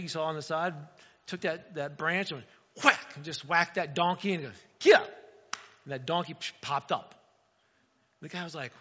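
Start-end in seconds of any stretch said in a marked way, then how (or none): 4.8-5.1 loud, high barked command; sharp shout. Retold or imitated. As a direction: snap the donkey to its feet. Farmer whacks donkey and shouts.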